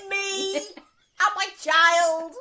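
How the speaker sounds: high, loud, drawn-out wail; slow hammed-up cry; retold meltdown. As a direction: carry on the comic despair. Meltdown while mopping up flood.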